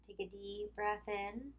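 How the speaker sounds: calm yoga instruction with long pauses; guided relaxation. Acted. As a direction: lead them through tensing and releasing, leaving room to breathe. Leads tense-and-release relaxation exercise.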